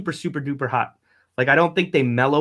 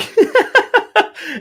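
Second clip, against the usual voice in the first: high laugh; 6 dB louder than usual. Real, not acted. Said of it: "good time for a sponsor break".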